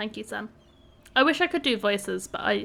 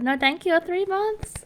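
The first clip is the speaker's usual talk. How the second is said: excited thanks; 7 semitones higher than usual. Real, not acted. Thanks subscriber with a high voice.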